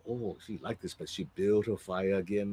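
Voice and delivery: plain reading voice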